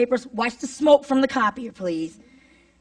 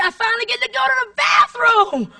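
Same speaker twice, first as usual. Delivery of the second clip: much higher, louder cry of relief; triumphant shout; 11 semitones higher, 5 dB louder than usual. Acted. Teacher finally gets a bathroom break, triumphant high shout. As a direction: build to a hammed-up high shout. Triumphant climax of hectic-day story.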